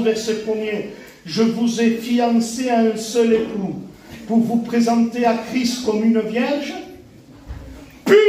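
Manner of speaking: normal talking voice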